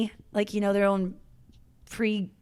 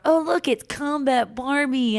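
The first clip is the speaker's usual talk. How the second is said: slow, sing-song mock call, pitched up; mocking taunt; 5 semitones higher, slower than usual. Marines in the chow hall jeering at her, mocking sing-song. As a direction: jeer it like the crowd pointing and laughing.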